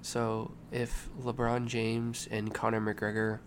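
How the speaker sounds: slow, hushed cat voice; quiet voice. Acted. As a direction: draw them in with a soft, slow voice. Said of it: "So I'm gonna talk quietly".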